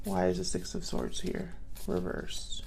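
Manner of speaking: softly asking oneself; under the breath. Real, not acted. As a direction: ask it quietly, half to yourself. Asking the cards under her breath.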